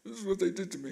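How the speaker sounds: upset, high-voiced complaint, retold; retold crying. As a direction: imitate a tearful plea to mama. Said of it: "and I'm crying".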